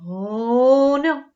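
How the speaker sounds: ordinary delivery, nothing marked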